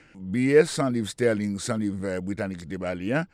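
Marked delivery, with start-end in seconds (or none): none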